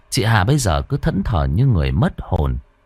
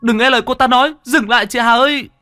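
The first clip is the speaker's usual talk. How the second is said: desperate shouting; 14 semitones higher than usual. Acted. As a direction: yell for her to stop. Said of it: "vừa chạy tôi vừa la hét". (hét gọi chị, cao và to)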